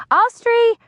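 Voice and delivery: loud, high yell up the stairs. A narrator's reading. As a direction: call her down sharply from below. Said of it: "Aunt yells up the stairs".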